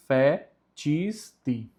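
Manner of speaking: "fetisti" broken slowly as "fe-tchís-ti"; slow, syllable by syllable, "ch" in the middle of "fetisti"; C as tchi. Shown as a demonstration. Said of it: "O si tem o som de ti". ("fetisti" devagar, sílabas separadas, "fetchísti")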